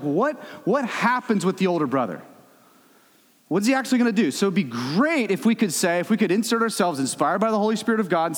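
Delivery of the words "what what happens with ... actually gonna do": higher, louder mock bewildered asking; imitating puzzlement. Reader wanting the parable's end, eager. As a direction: toss out the question in eager wonder. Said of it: "'Cause you're like".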